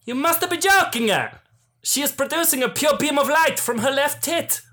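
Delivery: high, excitable mock-Italian accent; shaky Italian accent. Villain amazed by the heroine's light beam, high botched Italian accent. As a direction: exclaim in a bad, high-pitched Italian accent. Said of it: "I can't do an Italian accent".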